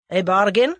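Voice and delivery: "bargain" loud, rising in a question; sharp query. A narrator's reading. Bearded man takes Tommy up, sharp questioning "bargain". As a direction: snap back "A bargain?" in disbelief. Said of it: "took him up sharply".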